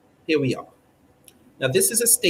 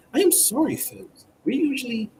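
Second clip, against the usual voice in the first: I am sorry jumps much higher in a flustered squeak; flustered apology; 8 semitones higher than usual. Acted. Anchor apologising to viewers for the missing statement, flustered high.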